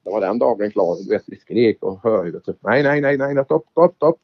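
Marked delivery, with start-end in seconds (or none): 2.6-4.2 half-yelled nej nej and stopp stopp; imitating the scared Italian yelling stop; retold shout. Rally driver imitating the scared Italian passenger's yell. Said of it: "skrek han, en italienare".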